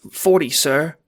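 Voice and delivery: high, loud, frightened answer; choked out. A narrator's reading. Employee answering the Don, choked and high. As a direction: force out a nervous reply. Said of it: "You choke out".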